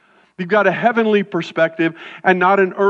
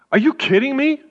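mock disbelief; 5 semitones higher than usual.

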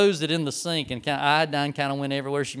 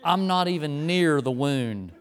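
retold disgust; 2 semitones lower, slower than usual. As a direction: imitate a fed-up nurse dragging out each word. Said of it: "with this disgusted look".

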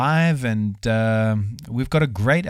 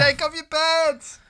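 high, slow heckling whoop; rowdy party animal; slower than usual. Acted. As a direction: heckle like a drunk party guest. Mock heckling from the crowd. (grölender Partylöwe)

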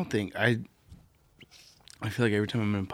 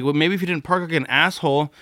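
angry; 5 semitones higher, 5 dB louder than usual. Retold or imitated. Arguing with rude driver.

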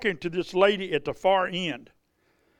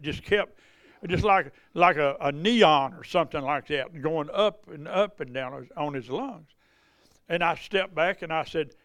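normal talking voice

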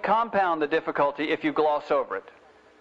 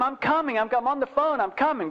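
mock harried call, much higher; retold yelling; 8 semitones higher than usual. Mother on the phone answering her children, mock yelling. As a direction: imitate calling out from another room without real volume. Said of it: "she kept yelling to them".

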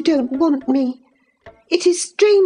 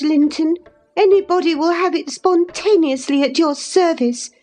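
put-on high-pitched, scolding young lady; in a passion; 12 semitones higher than usual. A narrator's reading. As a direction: scold him with scornful exasperation, voice pitched high. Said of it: "cried Catherine in a passion".

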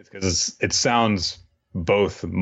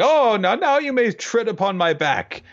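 high, oily groveling voice; fawning servant. Retold or imitated. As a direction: simper like a creepy toady. Groveling servant offers his back.

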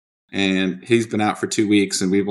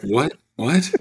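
confused what; 4 semitones higher than usual. Retold or imitated. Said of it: "I was like".

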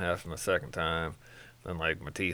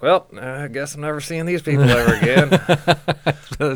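loud, slow, laughing line; 9 semitones higher, 13 dB louder, slower than usual. Retold or imitated. Walking out on the dentist, laughing loudly.

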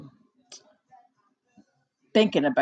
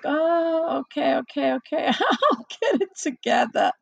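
sheepish laughing; 6 semitones higher than usual. Retold or imitated. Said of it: "I'm like".

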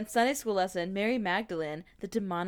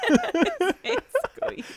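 his main squeeze through laughter, slow and high; laughing echo; 13 semitones higher, 5 dB louder, much slower than usual. Pretend. Co-host echoing the phrase back while laughing. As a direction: giggle the phrase out slowly.

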